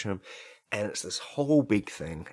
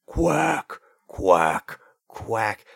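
slow, separate imitation quacks; duck quacking; 5 dB louder, much slower than usual. Mummy duck coming back to her nest, quacking. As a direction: quack like an approaching mother duck.

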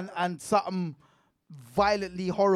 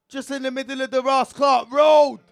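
loud, high exclamation; outraged; 6 semitones higher, 8 dB louder than usual. Real, not acted. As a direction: raise the voice in outrage. Outraged retelling of child in road.

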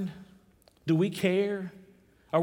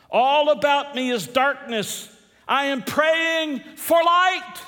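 raised, slow cry from the pulpit; impassioned plea; 8 semitones higher, 4 dB louder, slower than usual. Acted. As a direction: stretch the words out, high and loud. Preacher voices Gandhi's dying plea.

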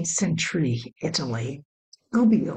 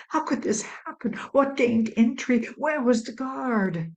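anxious voices; 6 semitones higher than usual. Acted. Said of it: "Anxious voices shot back and forth".

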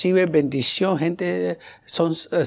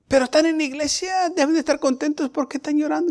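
higher, fast, baffled protest; incredulous friend; 10 semitones higher, faster than usual. Retold or imitated. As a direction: play the friend who can't believe church people cry. Amigo incrédulo por el llanto. (amigo incrédulo, agudo y rápido)

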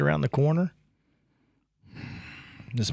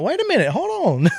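sudden realization; 12 semitones higher, 4 dB louder than usual. Retold or imitated. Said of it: "I'm like".

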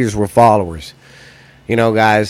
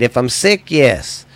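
ordinary talk

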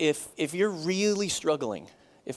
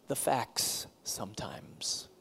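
slow hushed whisper; 7 semitones lower, 8 dB quieter, much slower than usual. Real, not acted. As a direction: drop to a secret whisper. Sermon point, dropped to whisper.

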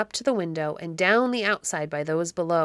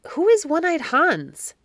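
much higher, rising in volume to an astonished question; startled questioning; 9 semitones higher, 4 dB louder than usual. A narrator's reading. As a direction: ask it high, as a bewildered boy just woken. Startled boy questions the stranger.

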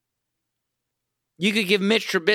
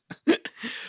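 breathy laugh; 4 dB quieter than usual. Real, not acted. Laughs at own anger.

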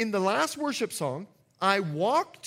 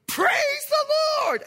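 high piercing shout of praise; sudden ecstatic high-pitched yell; 4 dB louder, slower than usual. Retold or imitated. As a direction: blast it out like a frenzied worshipper. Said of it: "and she comes up and she goes".

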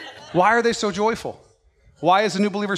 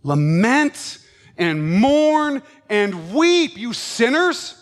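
very slow, louder lament; solemn exhortation; 4 semitones higher, 3 dB louder, much slower than usual. Acted. Preacher repeating James to sinners, very slow and urging. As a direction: drag it out, pleading with them. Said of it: "repeats verse, slower and stronger".

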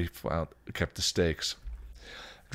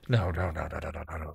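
rapid string of refusals, fading away; protesting; 2 semitones lower, 4 dB quieter, faster than usual. Acted. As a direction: protest as if backing off. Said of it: "Spike protested".

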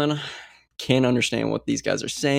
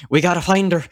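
louder, higher mock Irish line reading; imitated Irish accent; 6 semitones higher, 4 dB louder than usual. Imitating the film's American lead, accidental Irish accent. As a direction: mimic an actor slipping into an Irish accent. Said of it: "kinda doing an Irish accent right there".

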